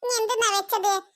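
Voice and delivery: normal talking voice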